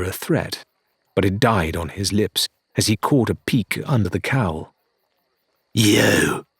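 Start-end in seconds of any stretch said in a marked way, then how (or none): 5.7-6.5 spat. Acted. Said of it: "he spat".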